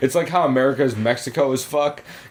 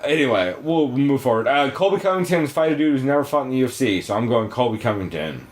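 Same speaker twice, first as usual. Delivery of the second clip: normal talking voice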